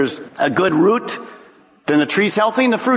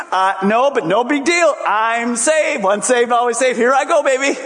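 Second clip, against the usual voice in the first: high, breezy, laughing it off; like nothing could ever touch him; carefree believer; 7 semitones higher than usual. Retold or imitated. A complacent believer shrugs off having no fruit, cheerfully high and laughing.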